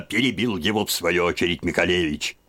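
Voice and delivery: usual speaking voice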